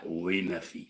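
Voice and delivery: low hushed spirit voice; ghostly father. Retold or imitated. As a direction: answer from beyond like a dead father. Dead father's voice through medium.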